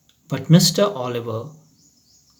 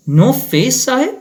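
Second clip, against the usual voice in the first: puzzled watchman; 10 semitones higher, 4 dB louder than usual. A narrator's reading. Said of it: "asked the watchman".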